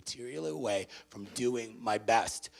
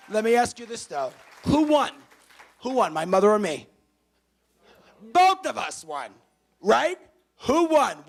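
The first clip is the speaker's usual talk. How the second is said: excited preaching; 10 semitones higher, 10 dB louder than usual. Real, not acted. Rallying the audience.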